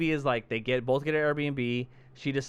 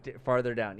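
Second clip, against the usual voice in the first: normal talking voice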